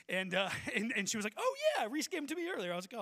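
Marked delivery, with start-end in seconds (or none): 1.4-2.7 high, loud, quick clerk voice; perky ticket clerk. Retold or imitated. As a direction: imitate a chirpy box-office worker. Ticket clerk's perky reply, acted.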